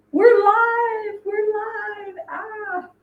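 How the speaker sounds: very high, sustained celebratory chant; excited singsong. Real, not acted. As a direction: sing it out high, then ease off. Celebrates being live on air.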